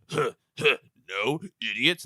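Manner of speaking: a lightly voiced tough-guy grunt; gruff Hulk. Hulk in the Santa suit brushes off the cops, lightly voiced as a tough guy. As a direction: sneer at the cops. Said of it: "And Hulk's like".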